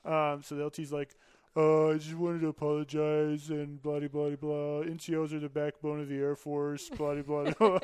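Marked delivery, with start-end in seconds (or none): 1.6-7.8 imitating a scripted apology in a droning monotone; mock rote apology. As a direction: recite it like reading off a card, blahs and all. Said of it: "He read it right off the card".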